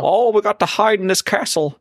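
high, clumsy mock Cockney; attempted Cockney accent. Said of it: "That's not even a Cockney accent".

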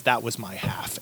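quiet, calm menace; an unbothered villain, dropped soft and low. Retold or imitated. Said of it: "he just calmly says".

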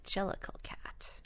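unvoiced whisper. Shown as a demonstration. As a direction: breathe it with no voice.

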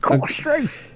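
high, put-on Australian accent; mock Australian. Joking along with the Australian bit in a mock accent. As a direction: toss it off in a silly Aussie voice.

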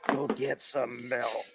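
slow, low villain growl; Green Goblin voice. Pretend. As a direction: order it like a sinister goblin. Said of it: "He just switches the Goblin voice".

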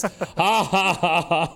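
a stiff, forced alien ha-ha; funny alien laugh. Retold or imitated. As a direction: imitate a clumsy learned laugh. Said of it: "he starts doing that funny laugh".

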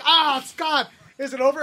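high-pitched pleading groan; hammed-up suffering moviegoer; mock exasperation. Re-enacts groaning through awful movie.

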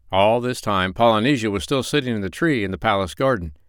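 plain reading voice

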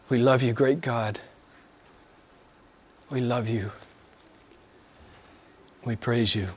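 hushed prayer with long pauses; heartfelt prayer. Real, not acted. Preacher praying to God for his people, reverent and subdued.

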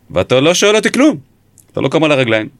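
retold accusation pitched high; high, pressing interrogator's challenge; incredulous confrontation. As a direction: confront a suspect in mock disbelief, voice jumping up. Acted interrogation, confronting a suspect.

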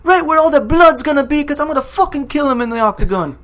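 loud, high, hyped-up shouting; full-volume imitation of trash talk; McGregor. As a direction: yell it like a fighter's boast. Acting out McGregor's boast.

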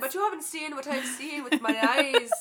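put-on half-hearted Irish accent, telling a spooky tale. Host jokingly trying Irish accent, light Irish lilt. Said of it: "do an Irish accent".

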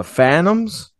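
ordinary talk

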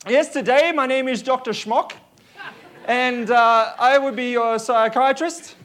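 mock German doctor accent; hammy German psychiatrist voice. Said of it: "as my German accent declines".

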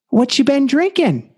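put-on high-pitched, playful old man voice; little old man. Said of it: "this little old Japanese man".

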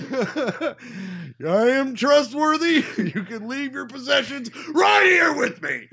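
high shouted impression with laughing breaks; Matt Foley. Hitchhiker vouching for himself, high hammed-up shout. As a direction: shout it like an overblown motivational speaker. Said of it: "my Matt Foley's a little rusty".

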